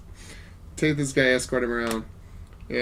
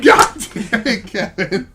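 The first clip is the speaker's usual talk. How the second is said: mock group groan; 6 semitones higher, 7 dB louder, much slower than usual. Group groaning at Kevin's failure.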